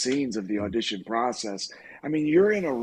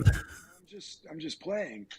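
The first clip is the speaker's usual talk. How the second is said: retold sheepish backpedal, much softer; backing down; 3 semitones higher, 6 dB quieter than usual. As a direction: play it down quietly. Backing down after threat, acted.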